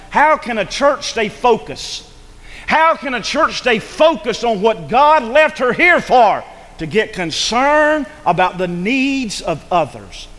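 loud, high, slow preaching build; fiery preaching. Real, not acted. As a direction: preach it with full force. Preaching at full force.

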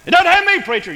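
high, loud mock objection; hammed-up defensive yelp; protesting churchgoer. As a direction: blurt out an indignant denial to the preacher. Acting a listener who protests.

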